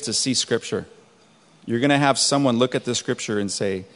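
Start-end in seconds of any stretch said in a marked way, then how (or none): none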